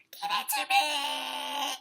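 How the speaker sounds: slow, stretched, high scream. A narrator's reading. Turtle demands Vulture's bracelet, screaming. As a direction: drag the screamed demand out long. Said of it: "screamed Turtle".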